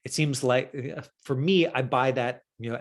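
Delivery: normal talking voice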